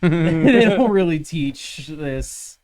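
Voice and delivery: slow words broken by laughter; laughing. Real, not acted. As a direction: laugh through it. Laughing through the line.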